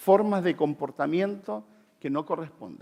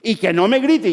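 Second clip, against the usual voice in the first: mock yell, louder; 5 semitones higher, 7 dB louder than usual. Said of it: "empiezo a gritar yo".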